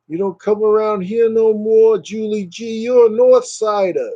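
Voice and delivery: high, hammed-up Jersey accent. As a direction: play a brash Jersey tough guy accent. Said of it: "That's a Jersey accent".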